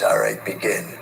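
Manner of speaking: a cool, unhurried Clint Eastwood calling the start; Clint Eastwood impression. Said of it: "That's a really good Clint Eastwood".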